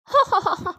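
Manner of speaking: short mischievous chuckle; cheeky crow laugh; sly laugh. Acted. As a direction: laugh at a trick that worked.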